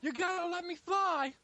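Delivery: high, slow pleading squeal; movie quote. Retold or imitated. Quoting the peacock line to friends, high and slow. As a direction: stretch it out high like a begging peacock. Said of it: "I'm a peacock".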